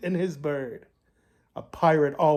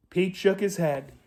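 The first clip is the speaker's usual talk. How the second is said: ordinary delivery, nothing marked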